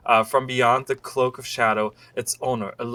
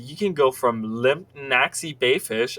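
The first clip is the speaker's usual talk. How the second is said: lively, swooping promotional read; sales-pitch reading; 3 semitones higher, 4 dB louder than usual. Acted. Reader presenting a romance guide to the lovelorn, sales-pitch style. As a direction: pitch it like an eager advertiser.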